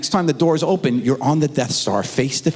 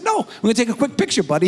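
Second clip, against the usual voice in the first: sing-song dad; 7 semitones higher, much faster than usual. Retold or imitated. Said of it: "I said".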